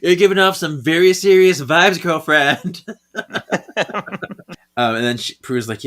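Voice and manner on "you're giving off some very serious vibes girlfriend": sassy. Retold or imitated. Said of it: "And she's like".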